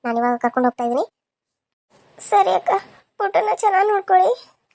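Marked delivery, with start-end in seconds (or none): 2.2-4.6 high, plaintive entreaty; tearful. Acted. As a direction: hand over your baby tearfully. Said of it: "ನಿಮ್ಮಮ್ಮ ಪಾಪ ಅಳ್ತಾ ಇದ್ದಾಳ". (ಅಳುದನಿಯಲ್ಲಿ)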